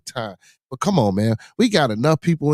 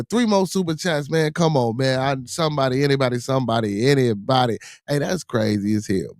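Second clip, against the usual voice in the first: ordinary talk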